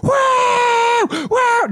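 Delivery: very high shrill-pitched mock scream; volunteer screaming over a heckler; retold scream. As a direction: scream to drown someone out. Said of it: "screaming in my ear".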